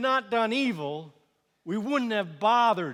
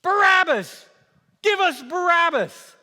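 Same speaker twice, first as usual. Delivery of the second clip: high, full-force chanting demand; mob scream; 13 semitones higher, 11 dB louder than usual. Acted. Crowd demanding another prisoner's release, screaming. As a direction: yell for him like a baying crowd. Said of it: "they screamed".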